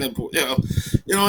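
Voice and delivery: normal talking voice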